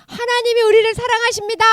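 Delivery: high, ringing proclamation; preacher's declaration. Shown as a demonstration. Teacher prompting children to answer amen, proclaiming. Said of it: "전사 한번 해볼 테니까 아멘 하세요".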